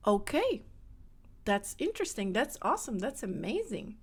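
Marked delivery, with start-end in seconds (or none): none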